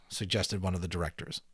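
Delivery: ordinary delivery, nothing marked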